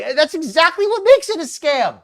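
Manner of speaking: loud, high-pitched outrage; real anger. Real, not acted. Host rebuts a scammer's excuse, angry. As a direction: hammer the point home, incensed. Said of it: "I'm so angry".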